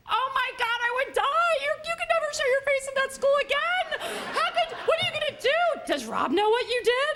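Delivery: high, loud, breathless alarm; hyper emotional friend. Retold or imitated. Emotional teacher friend reacting to the story, high and loud. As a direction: gush in dramatic horror. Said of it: "hyper emotional like me".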